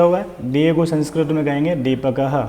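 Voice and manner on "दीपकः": दीपकः ending on an audible "ha"; sounded visarga. Shown as a demonstration. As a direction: pronounce दीपकः as "deepaka-ha". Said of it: "ह की ध्वनि निकलेगी विसर्ग के लिए".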